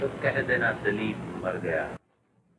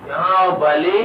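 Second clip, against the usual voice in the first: put-on loud, raised call to a child; 7 semitones higher, 9 dB louder than usual. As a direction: call the child over loudly. Calling the child over. (ऊँची आवाज़ में पुकारना)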